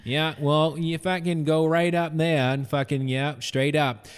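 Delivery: lightly put-on Maine accent; folksy Maine accent, loud. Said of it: "The Maine accent".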